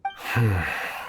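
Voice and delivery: soft tired exhale; heavy sigh before explaining; weary sigh. Acted. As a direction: let out a resigned sigh. Guard weary about the letter.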